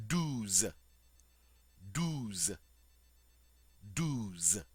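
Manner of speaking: douze said dooz; French douze. Shown as a demonstration. Teacher modeling douze for students, clear French. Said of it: "on dit".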